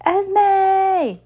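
high, loud scream of a name. A narrator's reading. Spoiled girl yelling for Esme from the pool. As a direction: yell across the pool. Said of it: "Carmelita screamed from the swimming pool".

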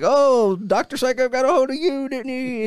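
high, loud creepy character voice; in character as haunt actor. Retold or imitated. Haunt actor teasing a soaked guest, high creepy voice. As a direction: slip back into the scare-actor voice. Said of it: "I'm trying to stay in character".

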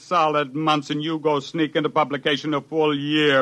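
angry outburst. Acted. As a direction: tear into him for stealing the work. Furious scientist berates Coffin.

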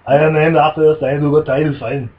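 lightly hammed-up Arnold introducing himself; host mimics Arnold playing Simon Templar, heavy mock accent; Arnold as the Saint. Repeats Arnold line.

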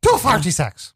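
high, mangled mock Irish; garbled Irish accent. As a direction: throw out a silly botched Irish accent. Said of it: "someone from Ireland having a stroke".

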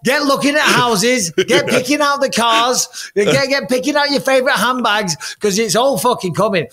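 rallying the wife to start celebrating; excited. Real, not acted. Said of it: "it's time to get excited".

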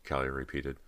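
plain reading voice

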